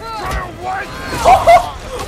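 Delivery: high-pitched burst of laughter; high laughing whoop. Real, not acted. Streamer reacting to the chase, high laughing whoop.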